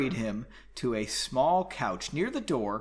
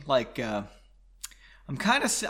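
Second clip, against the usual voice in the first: ordinary delivery, nothing marked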